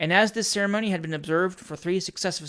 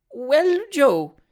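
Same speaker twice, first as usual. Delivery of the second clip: put-on high-pitched, nervous old lady; trembling old lady; 9 semitones higher, 6 dB louder than usual. A narrator's reading. Frightened old lady answering her servant boy, trembling. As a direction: play a timorous old woman, voice raised high. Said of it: "said the trembling old lady".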